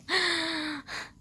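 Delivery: a hammed-up gasp like a swooning heroine; mock damsel gasp; mock dramatic gasp. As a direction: gasp theatrically. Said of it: "the girls is always like".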